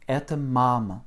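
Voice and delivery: "et mam" with a loud MA and near-silent last vowel; stressed first syllable. Shown as a demonstration. Teacher asking pupil to repeat after her, stressing MA in "mam". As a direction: hit the MA of "mam" hard, swallow the ending. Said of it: "c'est bien la première syllabe qui est accentuée".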